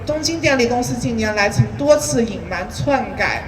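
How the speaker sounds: ordinary delivery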